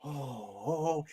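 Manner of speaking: put-on soft, breathless childlike thrill; Titus lighting up at talk of an ambush, giddy; giddy little-boy oh. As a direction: gasp like a boy on Christmas morning. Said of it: "that look of a little boy on Christmas".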